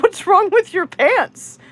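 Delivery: high-pitched, incredulous retold question; amused disbelief. Storyteller to the man with burnt pants, amused disbelief. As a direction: stretch it out high in mock astonishment.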